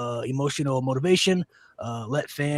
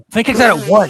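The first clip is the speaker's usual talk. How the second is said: louder, higher call on out at one; excited; 6 semitones higher, 14 dB louder than usual. Acted. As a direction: call it like a shocking kickout. Calling a match finish.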